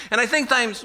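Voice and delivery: ordinary talk, nothing marked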